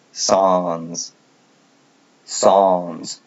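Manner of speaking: "sans" said singly with a long vowel; pronunciation demo. Shown as a demonstration. Correcting how the word is pronounced, careful demo of "sans". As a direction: draw out "sans" as a careful model. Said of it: "It's pronounced".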